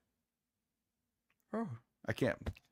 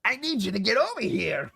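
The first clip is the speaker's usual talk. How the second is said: character voice; 7 semitones higher, 4 dB louder than usual. Retold or imitated. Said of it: "I can't do that very well".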